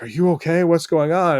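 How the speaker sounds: a hint of the family checking on him; worried family. Worried family stops the movie.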